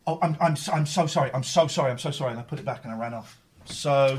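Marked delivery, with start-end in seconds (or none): 0.1-2.3 loud, gushing sorry; flustered apology. Retold or imitated. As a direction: apologise in a mortified rush. Mortified apology retold.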